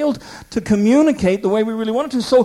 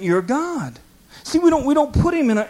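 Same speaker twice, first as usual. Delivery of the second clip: ordinary delivery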